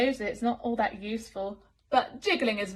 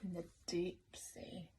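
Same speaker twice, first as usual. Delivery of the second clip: soft, breathy whisper; eerie whisper; 4 semitones lower, 15 dB quieter than usual. A narrator's reading. Reader to child, whispering to open the deep sea page. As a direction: whisper it into the dark depths.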